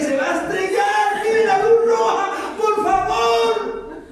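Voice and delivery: a driving teacher warning the learner about a red light; panicked warning. Retold or imitated. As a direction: shout a frantic warning at a driver. Panicked conscience warns driver.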